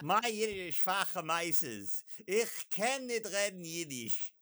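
slow, hammed-up Yiddish accent through laughter; clumsy attempt at a Yiddish accent. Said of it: "I can't do a Yiddish accent".

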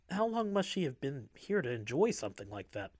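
plain reading voice

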